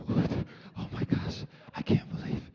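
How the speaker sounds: gasped, voiceless whisper of amazement; re-enacted hushed astonishment; retold breathless disbelief. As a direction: whisper it as if too stunned to speak. Retold hushed giggly disbelief at catch.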